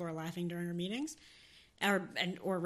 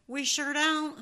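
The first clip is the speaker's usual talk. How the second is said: polite southern no; 6 semitones higher, 7 dB louder, much slower than usual. Retold or imitated. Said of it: "they started with".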